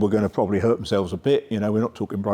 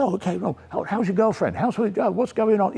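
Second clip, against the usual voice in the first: high, chatty imitation of a friendly coach; small talk in a much higher voice; Mark Cox; 9 semitones higher than usual. Coach chatting with player over ball pick-up, high imitated voice. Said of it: "Mark went round with them and said".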